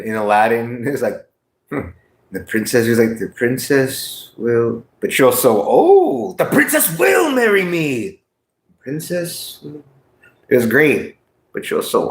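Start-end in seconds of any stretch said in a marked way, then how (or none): 3.2-4.8 Sultan voice. Retold or imitated. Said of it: "sounded like the motherfucking Sultan". 6.4-8.2 grand languid royal command; Sultan voice. Retold or imitated. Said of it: "sounded like the motherfucking Sultan". 8.8-9.6 Sultan voice. Retold or imitated. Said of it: "sounded like the motherfucking Sultan".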